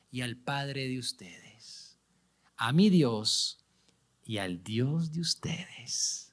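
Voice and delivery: slow, soft, fading into a whisper; tender slow reading. Acted. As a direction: let the words fall softly, slowly. Parte hermosa, leída tiernamente. (lectura lenta y suave que termina en susurro)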